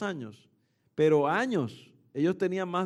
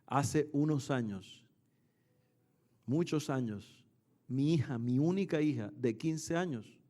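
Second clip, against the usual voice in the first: retold tearful story; 5 semitones lower, 6 dB quieter than usual. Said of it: "se puso a llorar".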